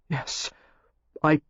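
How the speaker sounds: understated hoarse break. A narrator's reading. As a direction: voice catching, barely getting the words out. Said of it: "his voice was hoarse".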